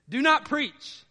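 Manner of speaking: emphatic quote. Retold or imitated. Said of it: "The phrase they say is this".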